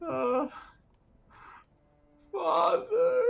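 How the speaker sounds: high, loud crying moan; pained moan. Acted. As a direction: moan it out in pain. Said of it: "kind of a pained moan".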